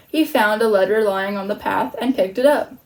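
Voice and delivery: plain reading voice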